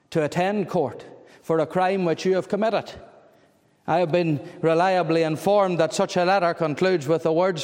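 ordinary talk, nothing marked

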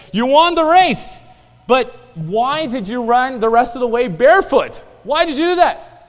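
loud, high-pitched acted exclamation; astonished reporters. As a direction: call it out like incredulous reporters. Acts out reporters questioning winner.

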